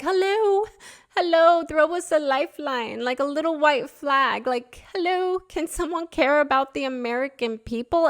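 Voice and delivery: high, sing-out mock cry for help; hammed-up plea for someone to care; pleading callout. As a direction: call out high as if stranded and unheard. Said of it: "if you were just like".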